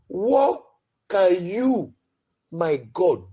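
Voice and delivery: usual speaking voice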